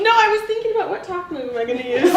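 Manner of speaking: laughing while answering. Real, not acted. Caught out by coach, laughing.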